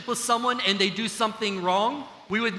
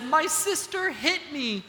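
put-on crying little girl; 6 semitones higher, 3 dB quieter, slower than usual. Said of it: "she was crying and she said".

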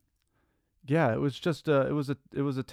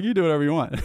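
higher, mildly hammed-up imitation of the sponsor; a hint of the stern sponsor, played for laughs; wide-eyed mock reply; 5 semitones higher, 4 dB louder, much faster than usual. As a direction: repeat it with an amused, exaggerated edge. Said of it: "He's like".

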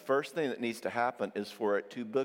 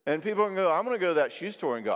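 normal talking voice

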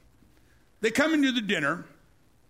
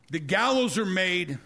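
ordinary delivery

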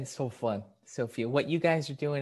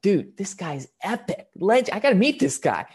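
excited, higher-pitched raving; awestruck reaction; 5 semitones higher, 4 dB louder than usual. Retold or imitated. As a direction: rave like a starstruck fan.

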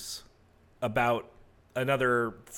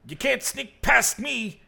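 high, strained cry from the refuse pile; creature's challenge; 12 semitones higher, 4 dB louder than usual. Acted. Figure in the refuse pile confronts a creeper, high-pitched challenge. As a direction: squeal it out, much higher and a little louder. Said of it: "you hear a sort of voice go".

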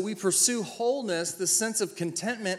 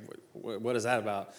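mock stammer; 7 semitones lower, 5 dB quieter than usual. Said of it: "He's like".

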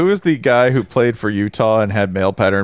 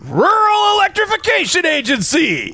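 screamed catchphrase. Shown as a demonstration. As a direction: cry it out as the ball flies. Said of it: "screaming out as the ball sails".

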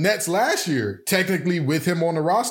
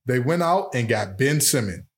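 ordinary delivery

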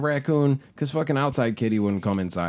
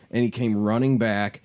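usual speaking voice

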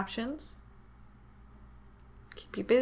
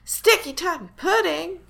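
high, loud rising echo; excited surprise; 8 semitones higher, 6 dB louder than usual. Real, not acted. Co-host reacts to recipe reveal, excited surprise. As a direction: repeat it as a delighted, rising question.

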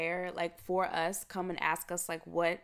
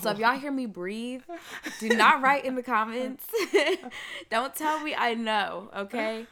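raised voice, half joking; loud playful warning; 3 semitones higher, 6 dB louder than usual. Real, not acted. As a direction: call it out to the listeners.

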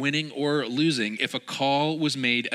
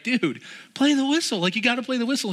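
high, loud pleading; urgent plea; 11 semitones higher, 3 dB louder, faster than usual. Retold or imitated. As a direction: beg him to play on. Teammates urging the defender.

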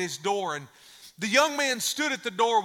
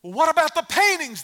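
high, loud outcry, short of a full scream; crowd's protesting scream; 8 semitones higher, 6 dB louder than usual. Acted. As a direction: cry out in indignant protest. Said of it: "they began to scream".

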